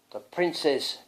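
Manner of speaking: ordinary delivery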